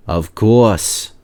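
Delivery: plain reading voice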